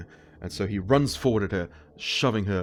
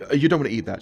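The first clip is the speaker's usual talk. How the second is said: higher, louder hasty warning; alarmed warning; 6 semitones higher, 8 dB louder, much faster than usual. Retold or imitated. Sophie stopping her father from eating the soup, alarmed. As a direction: cut him off before he eats.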